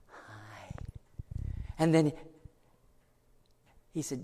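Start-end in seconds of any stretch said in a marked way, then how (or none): 0.1-0.7 tiny whispered hello; shy whisper. Acted. The little boy shyly greeting Jesus, whispered. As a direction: breathe it out shyly.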